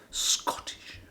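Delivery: breathy whispered word; whispering. Real, not acted.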